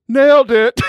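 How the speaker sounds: high, loud exclamation; Colin Jost punchline. Retold or imitated. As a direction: call out the tag line, breaking into laughter. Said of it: "Colin Jost, who's the host on the show".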